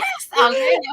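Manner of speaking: playful high-pitched put-on; mock high voice. Friend agrees to try accents in a high silly voice. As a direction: squeak it out in a silly voice. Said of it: "All right".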